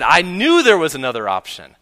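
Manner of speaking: put-on high, loud burst of a child's excitement; imitated gleeful shout, pitch leaping up; excited yelling. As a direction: act out a thrilled little girl yelling in triumph. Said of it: "jumping up and down and yelling with excitement".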